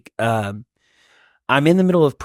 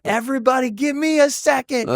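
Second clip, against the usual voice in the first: high-pitched frantic retold yell; mock scream; 13 semitones higher than usual. Overwhelmed dad to people stopping him, mock scream. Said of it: "like, screaming".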